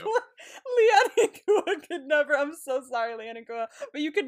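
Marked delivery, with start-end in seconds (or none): 0.0-2.4 higher and louder, words broken by laughter; laughing teasing. Real, not acted. Roasting another couple to her co-host, laughing.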